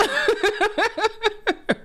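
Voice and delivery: a bright, high burst of laughter; high delighted laugh. Real, not acted. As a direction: let a giddy laugh out. Laughs after heartfelt thanks.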